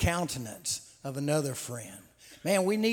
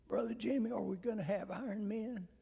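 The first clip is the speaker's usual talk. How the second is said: put-on frail old man; 5 semitones higher, 6 dB quieter, faster than usual. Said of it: "this elderly gentleman".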